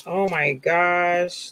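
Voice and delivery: ordinary talk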